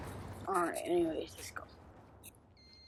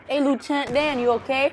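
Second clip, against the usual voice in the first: calling out; 8 semitones higher, 5 dB louder than usual. Real, not acted. Calling to fallen squadmate.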